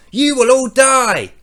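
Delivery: high, loud, slow proclaiming doom; hammed-up menacing decree; mock divine threat. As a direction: boom it out as a wrathful god. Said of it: "As in, Allah is going to say".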